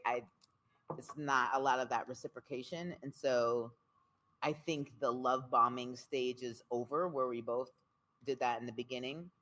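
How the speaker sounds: normal talking voice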